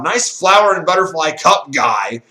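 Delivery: hammed-up jeering shout; mock scream-out. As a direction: imitate a heckler yelling from the crowd. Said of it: "someone's gonna scream out".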